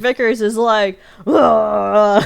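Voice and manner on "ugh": loud exasperated "ugh"; imitated furious groan; mock angry groan. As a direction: groan in hammed-up fury. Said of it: "She's so mad".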